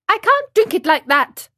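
put-on high, loud childish complaint; whiny puppet boy. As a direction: object like a fussy little marionette.

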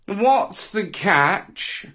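high, drawn-out cat character. Acted. Cat asks dog about the fish, slow cat voice. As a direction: stretch it out slowly like a wary cat. Cat questions the dog's gift.

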